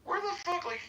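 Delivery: high incredulous "dude, where the fuck"; exasperated. Retold or imitated. To the staff, a high exasperated squeal. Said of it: "And I'm like".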